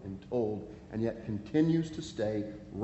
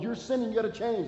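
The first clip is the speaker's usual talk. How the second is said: urgent warning; 9 semitones higher, faster than usual. Retold or imitated. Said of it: "they've been told".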